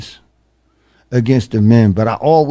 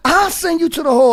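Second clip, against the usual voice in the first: mock threat; faster than usual. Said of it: "she was like".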